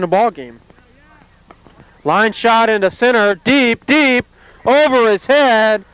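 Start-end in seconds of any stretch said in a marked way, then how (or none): 2.0-5.8 excited home run call. Real, not acted. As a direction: build the call as it flies over his head. Home run call on a deep drive.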